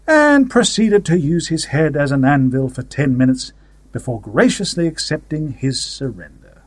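plain reading voice

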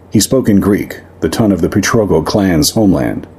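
ordinary delivery, nothing marked

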